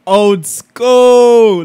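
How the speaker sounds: loud excited shout of the words; hype shout. Real, not acted. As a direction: burst out with the phrase, pumped. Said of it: "Sorry, I'm just hype".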